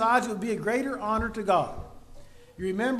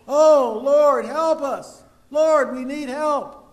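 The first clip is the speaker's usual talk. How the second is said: loud, slow, pleading cry for help; crying out to God; 5 semitones higher, 9 dB louder, much slower than usual. Acted. Oppressed people crying out to the Lord for rescue, loud and slow. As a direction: drag out each plea, begging loudly. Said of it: "they cry out to God".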